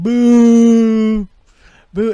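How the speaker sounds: loud, high, drawn-out mock boo; crowd booing. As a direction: boo like a whole talk-show audience. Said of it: "all the states were going like".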